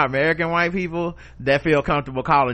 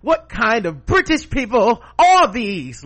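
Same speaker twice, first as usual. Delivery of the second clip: high, loud, slow scandalized put-on voice; mock pearl-clutching; 9 semitones higher, 6 dB louder, slower than usual. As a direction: gasp at them like a shocked aristocrat. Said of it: "we over here like".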